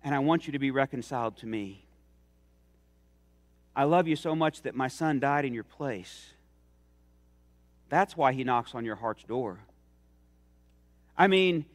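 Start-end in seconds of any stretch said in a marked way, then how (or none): 0.0-6.3 gentle, softer loving plea; tender God. Pretend. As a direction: call out gently as God at the heart's door. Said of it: "God knocking at your heart's door and saying".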